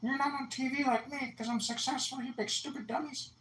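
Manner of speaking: very high cartoonish mocking voice; imitating Point. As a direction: squeak out a petty taunt. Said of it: "he talks like this".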